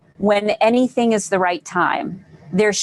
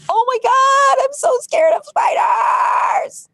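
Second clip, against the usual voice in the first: mock scared wail; slower than usual. Coach acting out spider panic for viewers, high mock scared wail. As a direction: wail it like a terrified child, half joking. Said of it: "recognizing that you have a fear of spiders and say".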